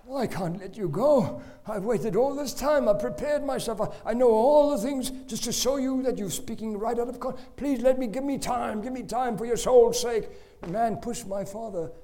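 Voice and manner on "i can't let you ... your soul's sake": high, loud, desperate begging; loud pleading. Retold or imitated. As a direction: beg him to give you time. Father pleading at the door.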